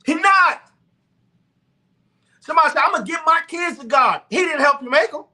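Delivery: high, loud preacher's exhortation; preaching at full force. Real, not acted. Preaching to viewers about using God's gift of wealth, high and loud. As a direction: preach it out hard to the congregation.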